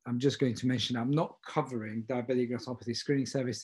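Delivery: ordinary talk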